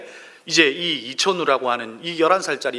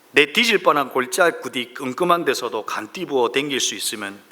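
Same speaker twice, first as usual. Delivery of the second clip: Psalm read in broad Gyeongsang dialect, lightly performed; 2 semitones lower than usual. Acted. Pastor reads Psalm 23 to his congregation in Gyeongsang dialect. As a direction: recite it like a Gyeongsang native, folksy and blunt. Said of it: "경상도 버전으로 말씀을 보겠습니다".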